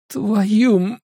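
high-pitched cut-off curse, no real volume; pained howl. A narrator's reading. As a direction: cry out high in pain, break off. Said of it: "Он так громко взвыл". (высокий вскрик боли, оборванный)

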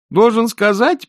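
high, loud whiny complaint; petulant complaining boy. A narrator's reading. Eric complains to Rincewind about the woman's outfit, petulant. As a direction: complain shrilly as a spoilt youngster.

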